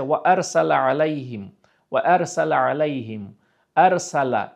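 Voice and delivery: আরসালা with a light, thin s; light sin. Shown as a demonstration. Teacher isolating the sin, clearly. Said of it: "এখানে কিন্তু সিন উচ্চারণ হয়েছে".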